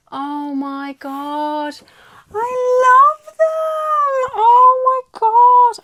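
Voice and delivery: very high, loud, slow shriek; excited squeal. Real, not acted. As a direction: shriek with pure joy. Shrieking over the stamps.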